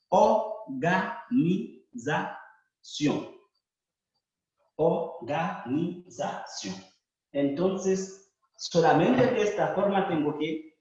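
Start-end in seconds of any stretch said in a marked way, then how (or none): 0.1-6.7 organización stretched slowly; slow demo. Shown as a demonstration. Teacher repeating organización slowly to the class. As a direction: say organización slowly, each syllable clear. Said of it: "O R se reemplaza por O acento".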